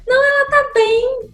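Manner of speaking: high-pitched mock reassurance; mimicked reply. Mimics people's reply, then corrects it. (imitação em voz aguda)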